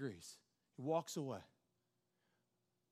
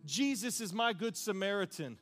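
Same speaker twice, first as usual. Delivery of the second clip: raised, forceful preacher's cadence; loud, high testimony from the pulpit; impassioned preaching; 6 semitones higher, 7 dB louder than usual. Acted. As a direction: preach it out with building intensity.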